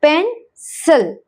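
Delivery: पेंसिल slowly as pen-səl; slow split पेंसिल, schwa in the last syllable. Shown as a demonstration. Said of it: "आई की sound यहाँ पर है अह". (पेंसिल धीरे-धीरे तोड़कर, शवा के साथ)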